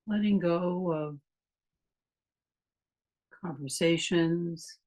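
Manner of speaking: calm meditation voice with long pauses; guided meditation. Real, not acted. Meditation teacher leading a class through a body scan, calm guided meditation. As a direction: guide them unhurriedly, leaving long silences between phrases.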